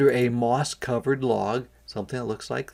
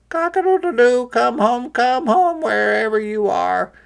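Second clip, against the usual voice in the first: much higher, louder crowing call; rooster crowing; 12 semitones higher, 7 dB louder than usual. A narrator's reading. Rooster calling the chick home, high loud crow.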